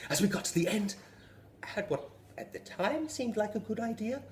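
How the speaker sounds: ordinary delivery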